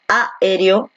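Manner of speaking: "aéreo" stressed on opening A, split off; slow demo, Á-ereo; misplaced stress. Shown as a demonstration. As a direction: wrongly punch the first A of "aéreo". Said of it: "Donde la fuerza de voz recae aquí en la E".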